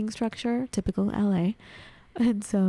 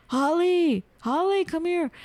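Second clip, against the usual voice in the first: yelling for Holly; 9 semitones higher than usual. Retold or imitated. The stranger flipping her pages, yelling for Holly to come over. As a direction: call out to a friend far off. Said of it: "he keeps yelling".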